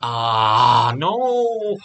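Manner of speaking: startled outcry as blood appears; alarmed cry. Real, not acted. Sudden nosebleed starts.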